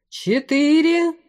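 high, slow drawn-out call; called-out countdown. A narrator's reading. General outside nearing five, calling it high and slow. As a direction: stretch the count and send it far.

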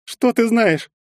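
high, sneering question; mocking. A narrator's reading. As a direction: throw it back at him with scorn. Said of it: "с издёвкой спросила Ольга".

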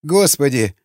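plain reading voice

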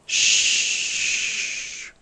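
long, soft "sss" of branches; drawn-out unvoiced rustle; whispering hiss. A narrator's reading. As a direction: hiss softly like whispering bushes. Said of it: "suttogták a bokrok ágai".

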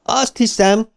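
high-pitched call back; shouted reply. A narrator's reading. Eddie answers Ben after falling, mild shouted reply. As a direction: answer as if calling out, without much extra volume. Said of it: "kiáltott vissza Eddie".

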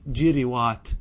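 جروات as "jiriwaat", two short i vowels; kasra on both. Shown as a demonstration. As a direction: say جروات with i after ج and after ر. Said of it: "بكسر الفاء والعين".

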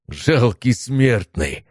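slow, contemptuous sneer; sneering demon. Acted. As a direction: sneer it out slowly. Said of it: "усмехнулся демон".

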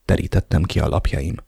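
plain reading voice

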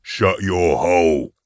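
slow, menacing put-on voice; warning henchman. A narrator's reading. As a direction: warn them off, drawing it out. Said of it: "warned Nil".

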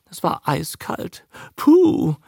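high, plaintive grumble ending in a squeal; complaining Lee. A narrator's reading. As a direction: fuss about it squeamishly. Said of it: "beschwerte sich Darling Lee".

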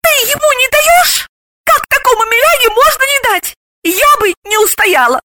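very high, voiced stage hiss; scandalised high-pitched reproach; disapproving hiss. A narrator's reading. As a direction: reproach your friend in a squeaky aside. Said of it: "осуждающе прошипела на ухо подруги".

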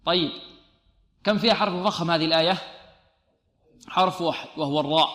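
ordinary talk

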